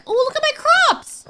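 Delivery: high, delighted exclamation; excited squeal. Real, not acted. Player admiring the new crops, excited squeal.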